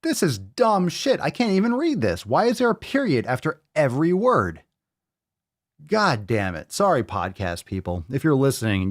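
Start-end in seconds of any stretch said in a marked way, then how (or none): 0.0-2.7 exasperated complaint. Real, not acted. Raging at caption.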